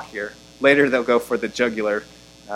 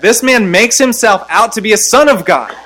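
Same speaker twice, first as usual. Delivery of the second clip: high, raised voice of accusers; loud accusation; 9 semitones higher, 6 dB louder than usual. Acted. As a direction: accuse him loudly before the governor.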